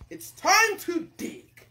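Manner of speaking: loud, high, stretched-out order; commanding king. Acted. King tells the cook the next job, commanding. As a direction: proclaim it slowly and grandly.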